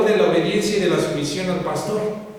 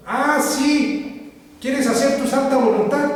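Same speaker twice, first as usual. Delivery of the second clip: higher-pitched, retold sarcastic reproach; mock-incredulous scolding, pitched up; indignant challenge; 6 semitones higher, slower than usual. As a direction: imitate a pastor challenging defiance. Pastor retold scolding a defiant member.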